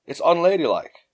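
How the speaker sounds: ordinary delivery, nothing marked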